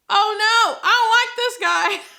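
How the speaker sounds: high, loud "oh no" reaction; real dismay at a villain; alarmed outburst. Real, not acted. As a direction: squeal it out in alarm.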